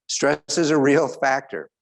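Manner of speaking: ordinary talk, nothing marked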